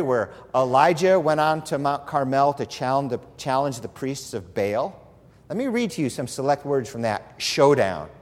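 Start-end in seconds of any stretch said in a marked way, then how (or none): none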